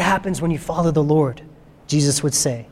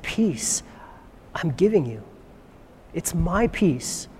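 hushed, unhurried assurance, much softer; calm confidence; 4 semitones lower, 8 dB quieter, slower than usual. Acted. Jesus giving his peace to the disciples, calm and confident. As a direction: give peace softly and with total calm. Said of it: "calmness, confidence, not afraid".

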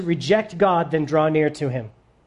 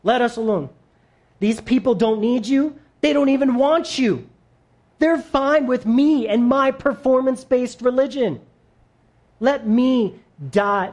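pleading demon; 6 semitones higher than usual. Pretend. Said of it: "He says".

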